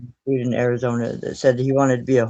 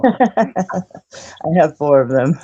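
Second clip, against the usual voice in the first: laughing; 4 semitones higher, 6 dB louder, much slower than usual. Real, not acted. Laughing while speaking, slow.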